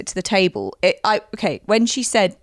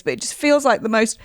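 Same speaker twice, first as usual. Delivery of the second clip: ordinary talk, nothing marked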